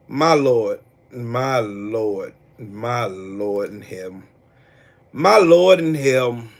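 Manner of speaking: low, slow lament; weary disbelief. Real, not acted. Streamer despairing at Tito's stupidity, low slow disbelief. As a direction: draw it out low in exasperation. Exasperated head-shaking at opponent's stupidity.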